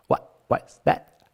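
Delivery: "What was that?" breathed slowly, final t dropped; dropped t, whispered. Shown as a demonstration. Teacher showing students how Americans say it, whispered. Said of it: "You say".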